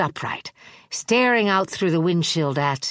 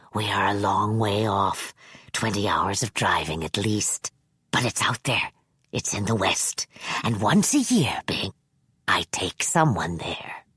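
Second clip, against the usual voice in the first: put-on low, whispery sinister old man; a creepy elder confiding a secret; 8 semitones lower than usual. Manx's held voice.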